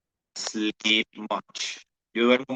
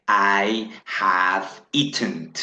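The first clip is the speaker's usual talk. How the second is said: slow model sentence; much slower than usual. Shown as a demonstration. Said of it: "miremos cómo".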